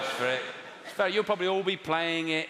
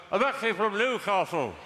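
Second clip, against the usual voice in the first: "I'm actually from Newcastle." said as if through a mouthful of cake; mouthful imitation; 4 semitones higher than usual. Comedian mimicking an audience member who sounds like he's eating, muffled. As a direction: mumble the line around a bite. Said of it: "Are you eating or something?".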